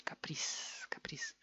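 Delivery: capriz whispered as kaprees; easy devoiced ending. Shown as a demonstration. Teacher showing the easier way, whispered devoiced ending. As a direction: whisper capriz with a long hissed s for z. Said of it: "mucho más fácil".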